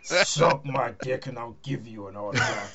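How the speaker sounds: low, slow tough-guy voice; imagined Braun Strowman quip. Retold or imitated. Wrestler brushing off an autograph request, low mock growl. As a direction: drop it low and drag it out. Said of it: "he probably said".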